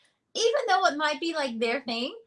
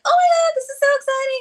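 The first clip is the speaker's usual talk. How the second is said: high, loud, fast squeal of delight; excited gushing; 8 dB louder, faster than usual. Retold or imitated. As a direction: gush with glee over it. Gushing at the fixed item.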